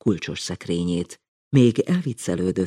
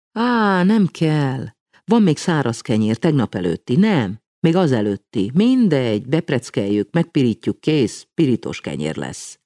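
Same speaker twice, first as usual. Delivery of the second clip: brisk reassurance; 6 semitones higher, 5 dB louder than usual. A narrator's reading. Juci brushes off bread worry.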